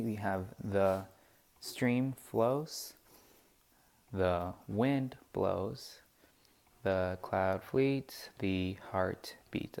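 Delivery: slow, each pair of words stressed on the second; stressed reading. Shown as a demonstration. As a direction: read the line beat by beat. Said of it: "he's adding the stress on these".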